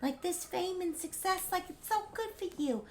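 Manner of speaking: lightly voiced sweet girlfriend; sweet Audrey voice. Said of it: "Audrey one is like".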